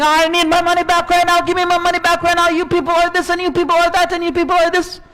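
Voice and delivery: mild mock yell. Lender grabbing the Prophet, demanding repayment in a mild mock yell. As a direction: play the rude lender demanding his money, understated. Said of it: "the man is yelling and screaming".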